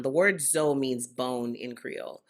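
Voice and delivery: ordinary talk, nothing marked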